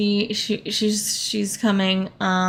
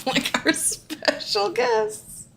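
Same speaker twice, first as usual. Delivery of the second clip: laughing; 10 semitones higher, 5 dB quieter, slower than usual. Real, not acted. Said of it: "could not even say it with a straight face".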